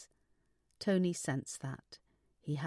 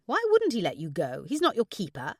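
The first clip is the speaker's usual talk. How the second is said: loud, indignant friend; forceful incredulous objection; exclaiming; 3 semitones higher, 7 dB louder than usual. A narrator's reading. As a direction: protest it loudly, in disbelief. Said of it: "exclaimed Gabby".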